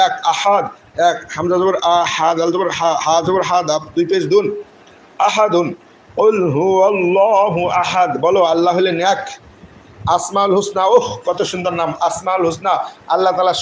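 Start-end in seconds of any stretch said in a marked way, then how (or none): 5.3-8.1 slow recitation of the verse; Quran recitation. Shown as a demonstration. Teacher reciting the verse for the class: slow. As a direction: chant it slowly and evenly.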